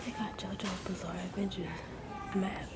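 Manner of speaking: hushed whisper in the library; quiet whispered tour of the shelves. Real, not acted. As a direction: keep it down, whisper so nobody hears.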